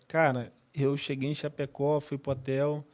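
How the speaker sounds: ordinary delivery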